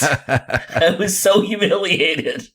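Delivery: slow, drawn out through laughter; laughing. Real, not acted. Mark recalling his school assembly blunder, laughing slowly.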